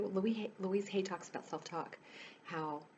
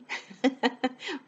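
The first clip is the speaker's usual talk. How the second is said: a bright, delighted laugh that breaks out; real gleeful laugh; 6 semitones higher, 10 dB louder than usual. Real, not acted. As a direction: laugh with real joy at getting away. Escaping into nature, no contact.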